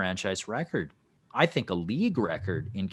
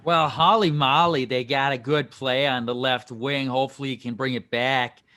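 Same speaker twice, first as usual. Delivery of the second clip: higher, louder hammed-up Boston accent; 5 semitones higher, 3 dB louder than usual. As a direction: throw on a thick Boston accent. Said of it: "I just wanted to say Hall in a, in a Boston accent".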